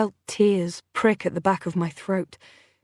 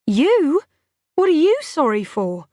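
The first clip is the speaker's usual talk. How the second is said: incredulous; 7 semitones higher, 4 dB louder than usual. A narrator's reading. Incredulous reply.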